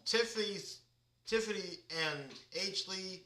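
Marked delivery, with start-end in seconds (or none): none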